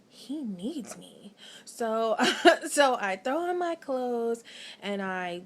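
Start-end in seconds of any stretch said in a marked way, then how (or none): 0.1-1.3 slow, soft inner thought. Retold or imitated. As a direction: think it to yourself, slowly. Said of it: "just thinking to myself".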